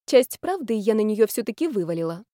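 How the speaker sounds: usual speaking voice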